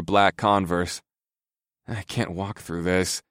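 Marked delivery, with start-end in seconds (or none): 1.9-3.2 slow, soft weary moan. A narrator's reading. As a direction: whine it out, worn down. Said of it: "I moaned".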